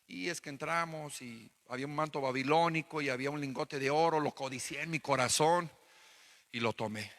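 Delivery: low, soft, hushed voice of a guilty man; Achan's confession. Retold or imitated. Achan, caught by Joshua, confessing in a low, soft voice. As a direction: own up quietly, head down.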